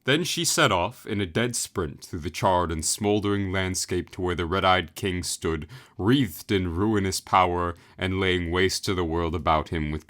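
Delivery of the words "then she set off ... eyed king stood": plain reading voice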